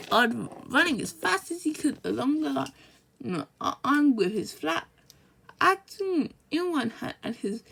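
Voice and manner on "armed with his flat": plain reading voice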